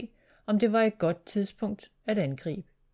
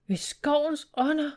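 higher-pitched exclamation of shock; an oath let out on a raised pitch; startled exclamation; 8 semitones higher than usual. A narrator's reading. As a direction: exclaim it in mild alarm. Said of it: "udbrød han".

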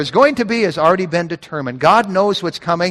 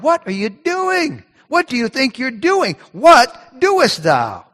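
high, drawn-out indignant questioning; high, slow, accusing questions; mock challenge to God; 8 semitones higher, slower than usual.

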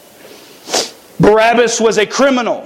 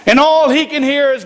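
ordinary delivery

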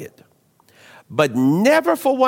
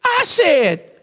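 much higher, indignant storytelling; hammed-up outrage; 11 semitones higher than usual. Pastor telling the church about a lady stealing his can, hammed-up outrage. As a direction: climb high with comic disbelief.

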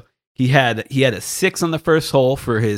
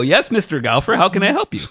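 sarcastic politeness; 9 semitones higher than usual. Retold or imitated. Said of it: "I go".

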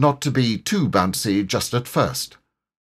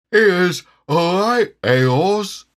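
high, loud garbled tiger; muffled words round a full mouth; 10 semitones higher, 6 dB louder, much slower than usual. A narrator's reading. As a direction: talk slowly through a mouthful of acorns. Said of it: "his mouth was full of acorns".

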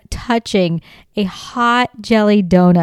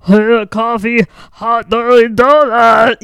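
loud, slow, dopey alien husband; mock alien voice; 3 semitones higher, 6 dB louder than usual. Wife mocking husband as an alien, loud put-on voice. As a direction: play a mindless alien taking an order, loudly. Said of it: "what he would sound like if he was an alien".

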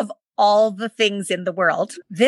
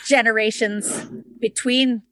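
title read backwards, word by word; saying it backwards; slower than usual. Shown as a demonstration. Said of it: "try to say that backwards".

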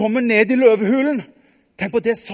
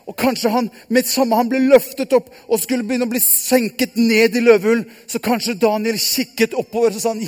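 normal talking voice

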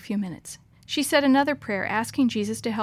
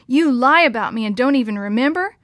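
angry accusation, raised volume; louder, angry; 2 semitones higher, 7 dB louder than usual. A narrator's reading. Sister confronts her brother about overheard talk, louder and angry. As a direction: accuse loudly, ending on an indignant question. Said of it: "her voice got louder".

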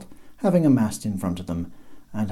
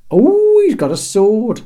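much higher, louder fake fright; mock terror shriek; 13 semitones higher, 12 dB louder, slower than usual. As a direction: shriek in pretend panic. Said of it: "shrieked Terry in mock terror".

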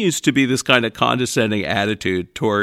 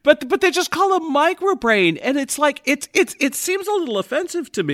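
mock indignant squeak; 12 semitones higher than usual. High put-on indignant voice.

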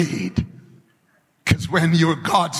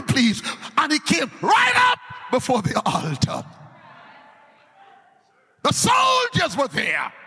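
preaching; 11 semitones higher than usual. Acted. Preacher proclaiming the word to the congregation. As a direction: call it out to the whole church.

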